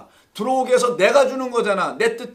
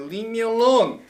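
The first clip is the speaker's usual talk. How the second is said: the master's voice; much slower than usual. Acted. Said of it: "이 주인이 그러고 있는 거예요".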